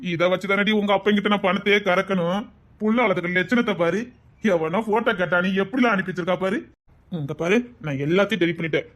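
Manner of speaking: ordinary talk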